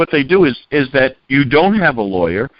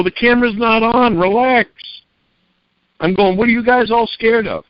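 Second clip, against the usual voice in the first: mock reassurance; 9 semitones higher than usual. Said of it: "I go".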